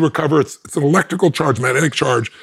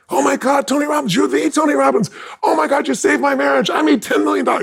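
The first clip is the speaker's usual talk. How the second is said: high, shouted star-struck gushing; gushing fan; 12 semitones higher than usual. Retold or imitated. Doctor meeting Tony Robbins, gushing in high excited shouts. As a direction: squeal like a fan meeting their hero.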